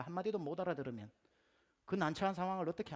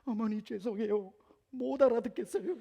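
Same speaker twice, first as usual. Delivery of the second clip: wife's apology; 6 semitones higher, 7 dB louder than usual. Retold or imitated. New wife apologizing to her mother-in-law, high and timid. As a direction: sound like a polite, flustered bride. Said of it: "저희 아내가 했던 말".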